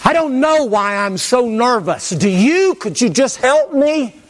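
high, slow, pleading mock nervous voice; nervous patient. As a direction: whine it like a jittery patient begging for help. Acting a nervous patient to doctor.

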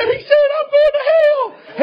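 imitating a boy screaming in panic; panicked cry. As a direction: shriek it out as if doomed.

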